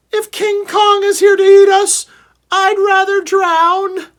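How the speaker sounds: a tiny terrified critter; squeaky frightened animal. A narrator's reading. A frightened animal in a cage squealing in panic.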